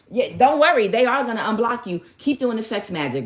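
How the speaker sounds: hammed-up confident comforting; mock reassurance. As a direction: insist cheerleader-style, play-acted. Mimics coaches' empty reassurances.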